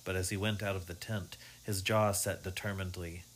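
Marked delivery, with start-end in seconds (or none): none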